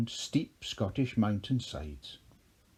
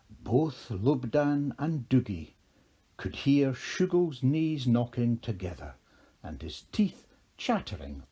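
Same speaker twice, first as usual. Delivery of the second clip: ordinary delivery, nothing marked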